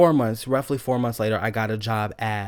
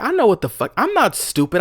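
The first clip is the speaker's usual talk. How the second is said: higher and louder, fierce; furious comeback; heated retort; 8 semitones higher, 4 dB louder than usual. Retold or imitated. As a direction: tell him off hard. Telling off manager.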